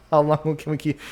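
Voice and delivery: words breaking out of a chuckle; laughing. Real, not acted. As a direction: start the question while still laughing. Laughs while voicing the controllers.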